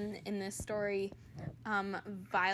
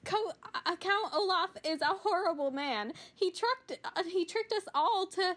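higher, desperate pleading; despair; 9 semitones higher than usual. Acted. Violet warning Mister Poe about Olaf, high despairing voice. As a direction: beg him to believe you. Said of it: "Violet said in despair".

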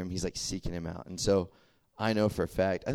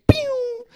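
shrill playful pew, much higher and louder; high squeaky mock zap sound; 12 dB louder than usual. Friends on the playground bail out with a loud high pew. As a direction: blurt a cartoon zap.

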